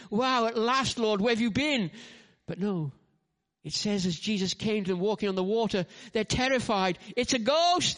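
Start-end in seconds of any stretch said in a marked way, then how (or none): 0.1-1.9 higher, louder relieved cry; relieved wow. Retold or imitated. Preacher voicing disciples greeting Jesus, relieved cry. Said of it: "You think". 7.2-8.0 high, loud mock terrified cry; frightened cry. Preacher acting terrified disciples on the boat, high fearful cry. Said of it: "they say, and cry out with fear".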